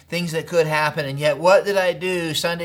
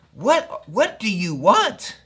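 retold frustration; 2 semitones higher, 4 dB louder than usual. Pastor to trip leader from the cab, frustrated. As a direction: draw out the words in acted exasperation. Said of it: "I got so frustrated".

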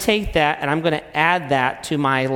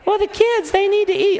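wife's voice. Acted. Acted quarrel, wife.

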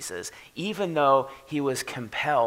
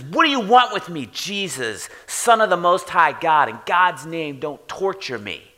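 loud, high, aggressive demon shout; demon screaming at Jesus; 4 semitones higher, 5 dB louder than usual. Retold or imitated. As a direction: hurl it at Jesus like a cornered spirit. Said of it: "he screams at the top of his lungs".

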